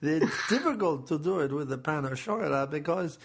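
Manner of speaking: wobbly, hammed-up French accent. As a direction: warn them in dubious mock French. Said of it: "what accent I'm doing right now".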